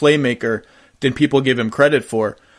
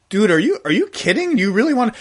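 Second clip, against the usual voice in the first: mock disbelief; 7 semitones higher, faster than usual.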